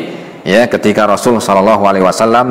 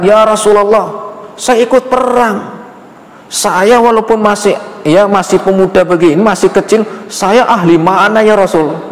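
put-on pleading child; 12 semitones higher than usual. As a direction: beg the Prophet to let him fight, like a small boy. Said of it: "Dia mengatakan".

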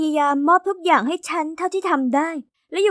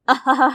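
put-on a teasing ha-ha-ha at the girls; mocking laugh; 3 semitones lower, 3 dB louder than usual. Boy laughing at two hugging girls, teasing laugh. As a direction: laugh at them like they're a joke.

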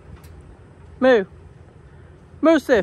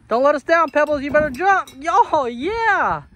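higher, rousing cheer; excited cheering; 4 semitones higher than usual. Real, not acted. Cheering Pebbles on to jump, excited. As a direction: egg them on, then whoop.

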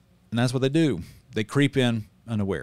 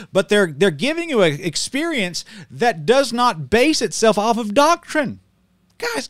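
preaching at full force, higher and louder; heated preaching; 9 semitones higher, 5 dB louder than usual. Real, not acted. Pastor warning his viewers about modernists, fiery preaching. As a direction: hammer it home like a fired-up preacher.

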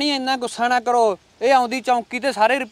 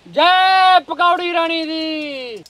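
high, long-held ritual call; drawn-out holy cheer; 4 semitones higher, much slower than usual. Acted. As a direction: call out the slogan slowly, stretching each word. Baba leads a devotional cheer. (ਲੰਮਾ ਖਿੱਚਿਆ ਜੈਕਾਰਾ)

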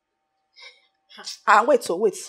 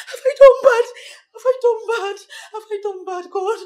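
loud tearful plea; tearful shout; 6 semitones higher, 4 dB louder than usual. Acted. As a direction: cry it out loud.

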